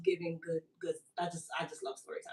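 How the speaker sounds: a breathy whispered confession; whispered aside. Real, not acted. Trailing confession, then back.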